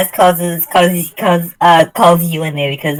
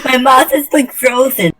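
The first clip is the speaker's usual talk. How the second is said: ordinary talk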